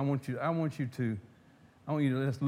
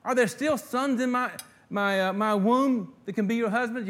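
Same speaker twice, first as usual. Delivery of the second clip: loud, high mocking plea with a sarcastic edge; bitter old widow mocking her daughters-in-law; 8 semitones higher, 7 dB louder than usual. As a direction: throw the question back with biting sarcasm. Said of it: "You hear the sarcasm?".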